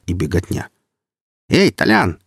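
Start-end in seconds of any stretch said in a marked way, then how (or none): 1.5-2.2 high, loud shout into the dark. Acted. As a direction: yell for Tolyan down the corridor. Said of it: "крикнул Калина в темноту".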